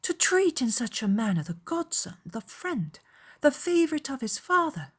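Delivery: put-on a young lady's indignant outburst; indignant exclamation. A narrator's reading. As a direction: exclaim in appalled disbelief, item by item. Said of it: "after a time exclaimed".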